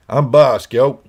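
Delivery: loud, high Brooklyn tough-guy voice; Brooklyn accent. Retold or imitated. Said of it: "talk like he was from Brooklyn".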